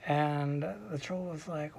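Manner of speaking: ordinary delivery, nothing marked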